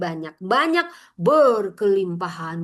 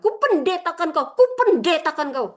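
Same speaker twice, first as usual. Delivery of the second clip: mock pompous pastor; 8 semitones higher, 5 dB louder than usual. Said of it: "ada yang kayak gini suka pendeta".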